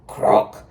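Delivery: loud unvoiced croak; frog croak. A narrator's reading. Toad says good night, croaking. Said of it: "he said".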